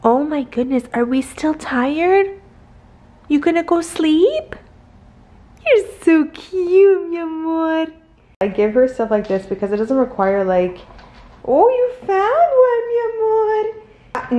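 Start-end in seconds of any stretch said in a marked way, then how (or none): 0.0-7.9 high, slow cooing. Real, not acted. Owner cooing at her sedated dog in high baby talk. 11.4-14.4 very high, slow squeal. Real, not acted. Owner squealing praise as her dog finds a treat.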